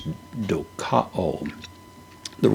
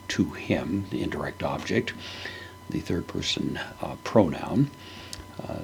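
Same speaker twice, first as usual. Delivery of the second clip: ordinary talk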